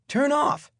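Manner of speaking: put-on a child trying out magic words; high eager little voice; kid's command. Boy commanding the bulb.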